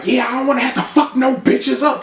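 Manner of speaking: lightly voiced hothead friend; mock Tommy. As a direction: warn them like a hothead. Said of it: "She's like".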